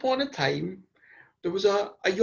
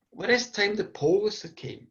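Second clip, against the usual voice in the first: usual speaking voice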